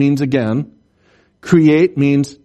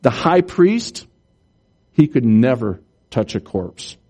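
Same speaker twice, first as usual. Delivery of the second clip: usual speaking voice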